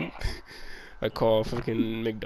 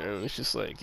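ordinary talk, nothing marked

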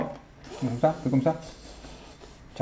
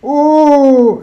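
mock angry gasp; 12 semitones higher, 9 dB louder than usual. Imitating dad's angry face.

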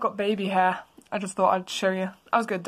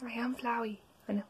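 hushed voiceless Flowey; creepy whisper; 10 dB quieter, faster than usual. Acted. Flowey answering Azzy, in a creepy whisper.

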